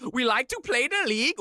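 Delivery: playful put-on Finnish accent; clumsy comic Finnish accent; mock Finnish accent. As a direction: tease the chat in a Finnish accent. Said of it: "don't do that accent".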